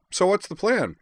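high, loud, eager character voice; Edward. A narrator's reading. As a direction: pipe it up brightly. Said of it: "declared in a high voice".

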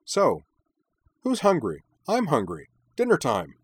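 high, loud and chirpy; eager rallying. A narrator's reading. Minami starts for the car, eager for dinner. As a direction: round everyone up for food.

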